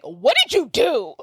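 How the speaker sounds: hammed-up accusing question, drawn out; acted outrage. [name] confronting Gwen after Layla leaves, slow outraged demand.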